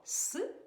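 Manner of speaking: С said hard, unpalatalized. Shown as a demonstration. Teacher confirming the answer for children, hard С. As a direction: give С as a hard sound. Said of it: "вимовляємо твердо".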